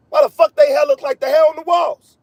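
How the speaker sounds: high, shouted disbelief; incredulous shout. Real, not acted. Streamer shouting at viewers about hair, incredulous. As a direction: yell it at the screen in disbelief.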